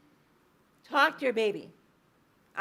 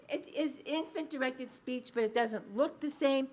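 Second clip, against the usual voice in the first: ordinary delivery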